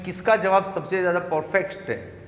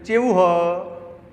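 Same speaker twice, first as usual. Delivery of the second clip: slow, stretched-out Gujarati dialect imitation; 2 semitones higher, 4 dB louder, much slower than usual. Shown as a demonstration. Teacher showing another way some Gujaratis greet, dialect imitation. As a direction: draw it out like a regional Gujarati greeting. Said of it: "कुछ लोग बोलते हैं".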